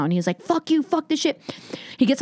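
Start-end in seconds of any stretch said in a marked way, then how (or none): 0.4-1.4 imitated angry outburst, pitched high; angry parting shot. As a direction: act out his storming off, voice up high. Retold comic storming off stage.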